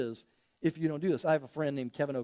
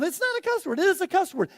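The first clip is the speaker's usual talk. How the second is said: mock bickering; 13 semitones higher, 6 dB louder than usual. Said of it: "They're arguing".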